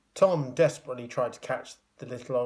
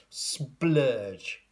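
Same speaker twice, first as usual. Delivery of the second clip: whispered sound effect. A narrator's reading.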